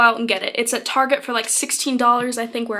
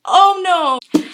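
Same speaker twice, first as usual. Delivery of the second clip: mock dismay; 5 semitones higher, 6 dB louder than usual.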